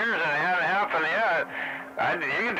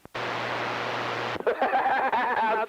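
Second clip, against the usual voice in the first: mocking laugh; 7 semitones higher than usual. Real, not acted. Laughing at rival.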